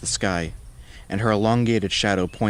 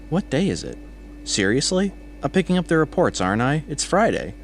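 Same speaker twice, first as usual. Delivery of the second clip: high-pitched, incredulous coworker; 8 semitones higher than usual. A narrator's reading. Coworker reminds Sarah why he came for reports, incredulous. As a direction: pitch it up in disbelief at her.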